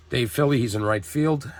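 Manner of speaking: ordinary talk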